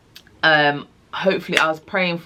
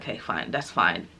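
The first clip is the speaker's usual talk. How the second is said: much lower, softer, deflated; resigned; 9 semitones lower, 6 dB quieter than usual. Retold or imitated. Told to wait, she gives in, lower and softer. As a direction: give in, defeated.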